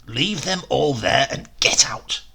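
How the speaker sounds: a touch of a rough, impatient order; swearing angrily. Acted. Dritto cursing his clumsy partner in the shop, mild angry order. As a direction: bark it out like a thief losing patience. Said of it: "Dritto swore at him".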